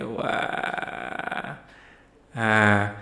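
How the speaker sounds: ordinary talk, nothing marked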